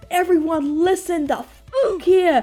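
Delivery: angry yell. Retold or imitated. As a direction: scold the kids into silence. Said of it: "and so I'm like".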